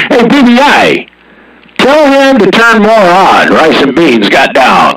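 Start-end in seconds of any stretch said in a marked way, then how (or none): 0.0-3.5 much higher, slow put-on voice with a laugh; high, cackling impression of a distant CB caller; imitating another radio caller. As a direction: mimic the guy on the radio, laughing through it. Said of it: "And then I hear".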